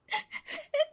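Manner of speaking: high breaking sob; one crying gasp. Acted. Neeta sobs before pleading.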